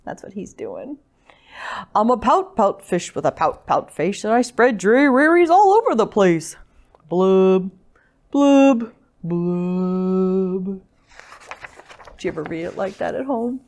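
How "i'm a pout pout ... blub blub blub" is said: sing-song mopey fish with drawn-out blubs; glum pout-pout fish. Acted. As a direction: chant the rhyme, sinking into gloom. Gloomy fish's refrain.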